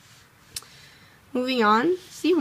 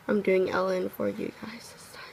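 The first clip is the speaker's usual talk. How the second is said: whispered aside; 2 semitones lower, 7 dB quieter than usual. Real, not acted. Muttered aside while writing.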